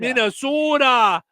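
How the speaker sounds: high, drawn-out Minnesota "Minnesota"; hammed-up Minnesota vowels; exaggerated Minnesota accent. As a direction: stretch the "so" in a mock Minnesota accent. Mocking the state's accent.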